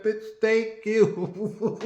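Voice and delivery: drawn-out, laughing thank you, slow; laughing reply. Retold or imitated. Teller recalls answering the stranger, laughing slowly. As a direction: laugh through the words, slowly.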